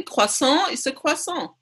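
"Croissant" said the French way, as kwa-SAHN with a nasal end; mother insisting on the proper French "croissant"; French pronunciation. Shown as a demonstration. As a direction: say "croissant" like a native French speaker. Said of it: "please pronounce it properly".

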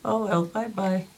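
ordinary talk

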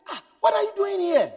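high, startled «what are you doing here»; a shocked friend asks why he is there; surprised. Retold or imitated. Dream friend meets friend in hell.

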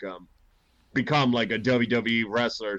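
ordinary talk

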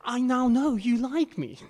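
gushing Oscar speech. Retold or imitated. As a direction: gush like a thrilled winner clutching her Oscar. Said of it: "she just tells them".

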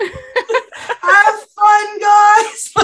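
high, loud laughing witch voice. Pretend. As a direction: greet them like a gleeful witch. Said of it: "that witch voice we were practicing earlier".

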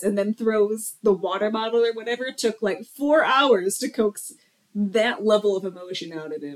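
ordinary delivery

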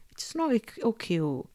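normal talking voice

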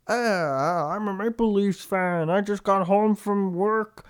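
high, slow Leafs fan voice; dopey suburban Toronto guy; Toronto dialect. Retold or imitated. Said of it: "Your regional dialects have gotten very on point".

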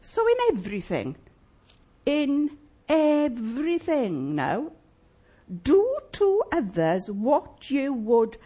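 slow, weighty reading of the verse; scripture reading. Acted. Preacher reads the verse slowly to the congregation, weighty reading. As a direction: draw out each phrase of the verse.

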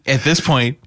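usual speaking voice

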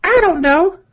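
high-pitched put-on girlfriend voice; hammed-up girly texting voice. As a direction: read it in a mock-girlish falsetto. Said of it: "a girly voice for this part".

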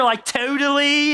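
a light mock Valley Girl. Preacher jokes that John sounds like a Valley Girl, mildly mocking. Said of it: "the original Valley Girl".

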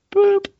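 high, loud boop like a scanner beep; imitated beep. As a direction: squeak the machine beep. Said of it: "Put your four fingers here".

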